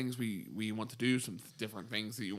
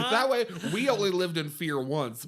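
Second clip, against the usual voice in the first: laughing; 6 semitones higher, 4 dB louder than usual. Real, not acted. Laughing at his own joke.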